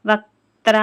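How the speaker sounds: వక్త్ర said loud with the kt and tr conjuncts pressed hard; stressed conjunct. Shown as a demonstration. Teacher showing the conjunct letters in వక్త్ర, loud and firm. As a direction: hit వక్త్ర firmly on its clusters. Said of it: "కా కింద తా ఒత్తు వచ్చింది".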